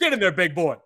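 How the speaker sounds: high, loud urging; excited cheering. Real, not acted. Color commentator urging on a player, excited cheering. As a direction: egg the big man on.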